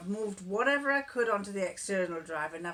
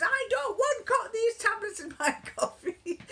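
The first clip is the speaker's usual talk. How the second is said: high, loud protest, slowed, half laughing; mock protesting husband; 13 semitones higher, 3 dB louder than usual. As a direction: whine it like the aggrieved husband.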